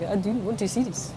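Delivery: a hint of a South African voice; South African accent, mildly imitated. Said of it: "he had a South African accent".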